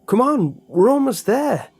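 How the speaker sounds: high, loud urging shout on the run; loud breathless encouragement while fleeing; urgent shout. Acted. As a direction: push him onward, yelling. Jeremy urging on while running.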